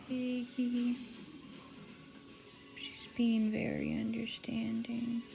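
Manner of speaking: high, sweet pet talk. Real, not acted. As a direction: coo to your pet cat. Cooing to her pet.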